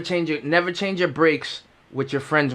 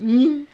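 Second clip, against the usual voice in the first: high, louder imitated creak; tire creak noise; 10 semitones higher, 4 dB louder than usual. Tire bends again, high squeaky noise. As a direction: squeak like the bending axle. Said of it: "It went".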